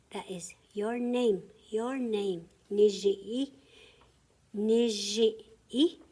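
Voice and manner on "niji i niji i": "Niji i" called out loud and clear; louder drill for the class; loud teaching voice. Shown as a demonstration. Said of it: "Got it?".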